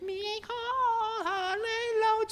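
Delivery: very high, soft slow parrot squeak; squeaky parrot. A narrator's reading. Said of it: "squeaked the parrot".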